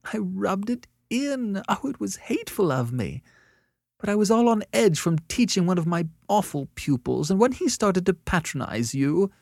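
high-pitched, tearful self-reproach; miserably. A narrator's reading. Annette blames her awful day for her hatefulness, miserably. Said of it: "she said miserably".

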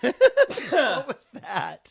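laughing out the question; amused, slow laugh through words. Real, not acted. Laughs at sudden sneeze.

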